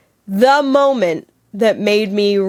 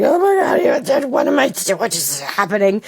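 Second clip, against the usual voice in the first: retold inner panic; mock panic that fizzles out; flustered panic. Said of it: "I was so scared".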